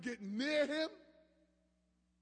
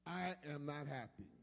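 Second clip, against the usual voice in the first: much lower and softer, calm; quiet, pointed displeasure; 7 semitones lower, 8 dB quieter than usual. Retold or imitated. Jesus making his displeasure known without raising his voice. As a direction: say it low without fussing. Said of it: "but he made a point".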